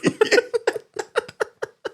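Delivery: high, voiceless wheezing laugh; breathless wheeze laugh. Real, not acted. Cracking up at a friend's joke, voiceless wheeze.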